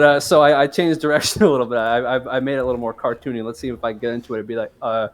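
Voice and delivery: normal talking voice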